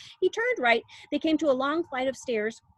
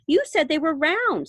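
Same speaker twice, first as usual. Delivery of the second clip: put-on higher, louder spoilt girl; Veruca; 6 semitones higher, 6 dB louder than usual. A narrator's reading. Veruca Salt contradicting Wonka, spoilt and loud. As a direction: insist like a bratty child. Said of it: "said Veruca Salt".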